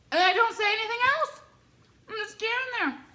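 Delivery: very high-pitched exasperation; squeaky disbelief at her own awkwardness; mortified retelling. Real, not acted. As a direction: voice shooting up in embarrassment.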